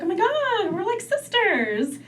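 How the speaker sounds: put-on eager squealy girl voice; gushing girl. Young [name] gushing to other girls, high and eager. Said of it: "I'd be like".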